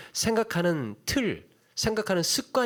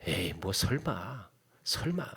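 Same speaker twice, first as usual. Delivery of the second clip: dismissive scoff; 3 semitones lower, 5 dB quieter than usual. Retold or imitated. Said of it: "그 속으로 그러는".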